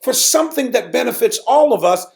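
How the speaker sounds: high-pitched wounded reproach to the congregation; hurt indignation. Retold or imitated. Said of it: "I got emotional about it".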